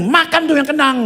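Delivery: loud, high mock scolding; retold angry outburst. Mother slamming fish down for her son, loud acted anger. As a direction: fling it out like a furious mom. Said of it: "Maka dia marah-marah".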